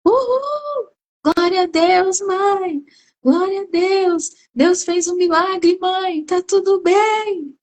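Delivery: alto e eufórico, aos gritos de alegria; filho comemorando. Retold or imitated. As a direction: celebrar o milagre com júbilo. Ele manda mensagem. (filho eufórico gritando de alegria pela cura)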